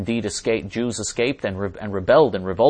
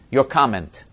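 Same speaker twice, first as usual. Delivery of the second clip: ordinary talk, nothing marked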